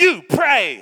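loud, high shouted command; barked order. Retold or imitated. The colonel father shouting at his son to pray. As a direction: bark the order across the room. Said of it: "leans around and goes".

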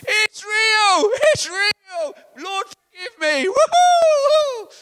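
very high, very loud tearful cries of joy; ecstatic shouting. Retold or imitated. Old man on the church floor, ecstatic crying shout. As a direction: yell it out overcome and weeping with joy.

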